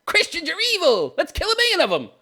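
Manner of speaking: high mocking sing-song voice; mocking leftist voice. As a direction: parody the other side. Voicing the people he mocks.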